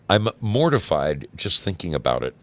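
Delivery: ordinary talk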